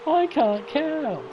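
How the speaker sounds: high, drawn-out wail of self-mockery; amused disbelief. Real, not acted. Commentator just after laughing at his miscount, amused wail. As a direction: stretch it out slowly, pitch way up.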